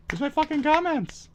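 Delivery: shrill high-pitched demand, pitch shooting way up; angry demand. Acted. Ranting viewer demands his deleted comments, outraged.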